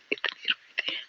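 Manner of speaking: soft, breathy whisper echoing the line; hushed repeat. Retold or imitated. Recalling crying in class, whispered softly. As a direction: drop to a whisper as if overcome.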